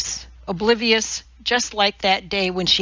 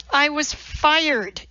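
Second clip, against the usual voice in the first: furious; 7 semitones higher, slower than usual. A narrator's reading. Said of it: "her voice filling with fury".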